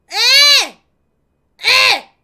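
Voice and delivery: shouted huh at the screen; loud high huh. Real, not acted. Streamer shouting huh at the chat, loud. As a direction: bark a questioning huh.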